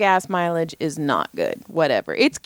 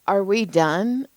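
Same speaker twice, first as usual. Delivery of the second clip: slow cheeky question; slower than usual. Retold or imitated. Retold cheeky question to cop.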